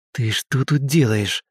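slow, restrained hiss; hissed question. A narrator's reading. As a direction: hiss it under control, taking your time. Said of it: "прошипел я". (сдержанное шипение)

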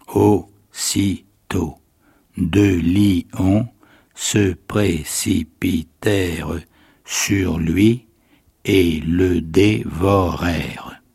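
very slow and solemn, syllable by syllable; grave halting reading. Acted. The orphan girl reading on to the sleeping household, slow and grave. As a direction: read it gravely like a child sounding out words. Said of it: "l'enfant continuait sa lecture d'un air grave".

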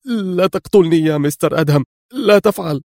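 high-pitched begging for his life; terrified plea. A narrator's reading. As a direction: plead in terror, pitch climbing. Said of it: "وهو يرتجف ويهتف في رعب". (توسل مرعوب)

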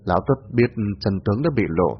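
plain reading voice

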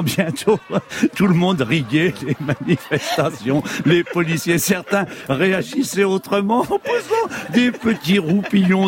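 giggling through the words; reading while fighting off laughter; laughing. Real, not acted. Narrator laughs describing laughing crowd.

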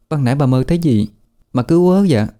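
put-on high, quick young girl's voice; servant girl Hoa. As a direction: chatter at her mistress. Servant Hoa speaks to mistress.

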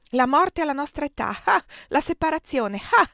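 high, loud scoff with spoken "Ah!"; scornful laughing disbelief. A narrator's reading. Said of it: "egli rise". (incredulità beffarda, acuta e forte)

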